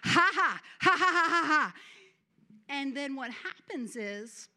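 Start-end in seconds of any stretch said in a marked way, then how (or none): 0.0-1.7 loud, forced ha-ha laugh as an act of faith; deliberate faith laughter. Shown as a demonstration. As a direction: laugh out loud on purpose to stir up joy. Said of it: "I activate joy".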